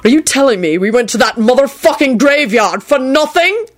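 high, climbing indignation; shouted outrage. A narrator's reading. Man learning the graveyard trip was pointless, outraged mock shout. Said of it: "Quentin shouted".

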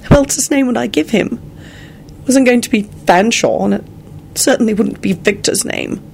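high-pitched bewildered woman; bewildered, pitched well up. A narrator's reading. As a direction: protest in baffled surprise. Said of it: "replied in a bewildered tone".

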